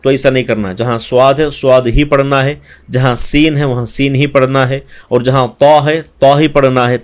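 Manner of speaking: normal talking voice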